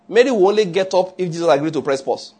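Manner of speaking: ordinary talk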